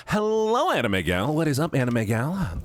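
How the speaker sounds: high put-on greeting voice, lightly done; a cutesy streamer voice, not fully held. Streamer greets Anime Gal in her other voice. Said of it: "I forgot I'm doing this other voice".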